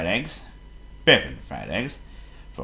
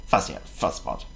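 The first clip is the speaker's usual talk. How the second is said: ordinary delivery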